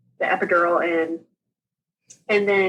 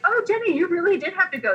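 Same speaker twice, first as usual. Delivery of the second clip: amazed; 10 semitones higher, faster than usual. Retold or imitated. Retells nurses amazed at full catheter.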